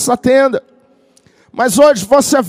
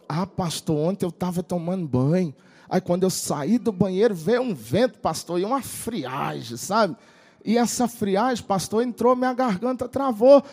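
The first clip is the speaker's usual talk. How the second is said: imitating a hoarse, croaky young man, low and soft; 5 semitones lower, 9 dB quieter than usual. Pastor imitating his hoarse worship minister, croaky and soft. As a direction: mimic a voice that has nearly gone, lower and softer. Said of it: "falando igual o Pato Donald, aquela voz bem rouquinha".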